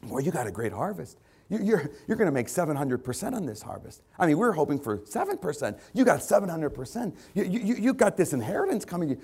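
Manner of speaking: a touch of an amazed money man; excited accountant. Acted. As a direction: break thrilling news, pitch climbing. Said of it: "the accountant came in and he says".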